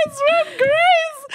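high, loud, slow laughing wail; laughing as a tearful guilty kid; laughing mock sob. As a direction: whimper it out while cracking up. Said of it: "I'm like".

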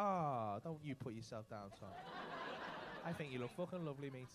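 hammed-up soft consoling; mock tender. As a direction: coo at him like a sweet nan.

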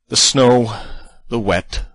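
loud, high complaint about the weather. Acted. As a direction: blurt it out. Complaining loudly.

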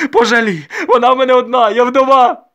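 loud, very high lament; wailing plea. A narrator's reading. Widowed mother begging for her only daughter, wailing. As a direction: beg in a desperate wail. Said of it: "зголосила солдатка Дакія".

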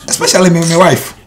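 loud, high protest; offended, forceful declaration; indignant emphasis. Acted. Indignant retort in a quarrel.

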